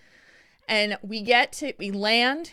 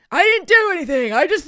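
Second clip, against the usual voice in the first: loud, high defensive whine of an angry man; mock outburst, half laughing at him; hammed-up furious denial, much louder and higher; imitating angry protest; 8 semitones higher, 9 dB louder than usual. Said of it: "he starts arguing with her".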